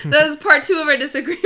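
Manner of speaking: laughing while talking. Real, not acted. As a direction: say it through a real laugh. Laughing about their disagreement.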